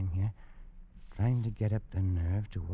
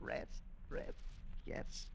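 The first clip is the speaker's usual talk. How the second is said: breathy whisper, much softer, drained; faint whisper; 12 dB quieter, slower than usual. Acted. Pollock exhausted after his fit, whispering to himself. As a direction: sink back murmuring, spent.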